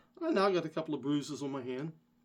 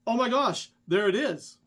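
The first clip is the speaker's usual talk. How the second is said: sudden high, loud cry of discovery; excited find; 6 semitones higher, 6 dB louder than usual. Retold or imitated. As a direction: blurt it out like you just spotted it. Wife spots lost key card.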